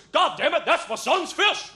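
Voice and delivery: loud, high, drawn-out mock roar; hammed-up fatherly battle cry; heroic father's outburst. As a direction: bellow it like a dad charging to the rescue. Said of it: "most beautiful example of fatherliness".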